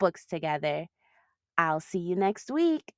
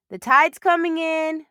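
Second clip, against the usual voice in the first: put-on higher, louder call to her child; mom shouting; 9 semitones higher, 7 dB louder than usual. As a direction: call out across the beach. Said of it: "Mom shouted".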